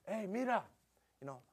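put-on quoted teen whispering in Spanish; teenager's remark. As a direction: mimic a teen nudging a friend. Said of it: "one of them says".

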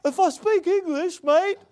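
high, mocking Australian accent; bad Australian accent. Preacher imitating the Australian guy, mocking bad accent. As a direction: put on a poor Aussie accent. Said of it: "I can't do a good Australian accent".

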